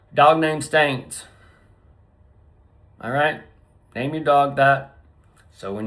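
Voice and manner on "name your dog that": usual speaking voice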